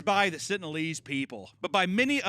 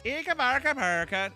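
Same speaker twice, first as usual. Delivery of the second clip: high fake Swedish babble; mock Swedish gibberish; 9 semitones higher, 4 dB louder than usual. Host testing a Dutch accent, fake Swedish gibberish. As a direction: bounce through fake Swedish. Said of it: "Isn't that Dutch or is that Swedish".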